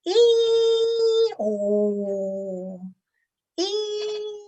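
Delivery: high donkey hee-haw, ringing out; donkey bray. Pretend. Teacher showing the class a donkey bray. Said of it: "we pretend to be the donkey".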